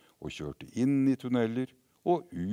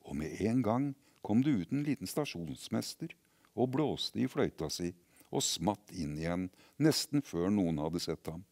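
ordinary delivery, nothing marked

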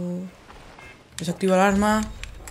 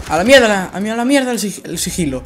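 loud exasperated cursing; 5 semitones higher, 7 dB louder, faster than usual. Real, not acted. Gives up on stealth.

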